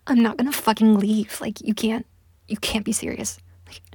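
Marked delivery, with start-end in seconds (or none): none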